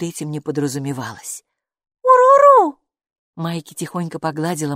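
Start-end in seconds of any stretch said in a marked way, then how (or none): 2.0-2.8 put-on high, loud child's echo of the creature word; girl repeating. Майки, маленькая девочка, громко повторяет «Уруру» за Букой. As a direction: copy the strange word eagerly. Maiki excited call.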